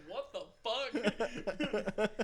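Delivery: slow, drawn-out baffled what the fuck. Retold or imitated. The dad baffled by a stranger's comment, slow drawn-out disbelief. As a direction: stretch it out in disbelief. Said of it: "he just looked at you like".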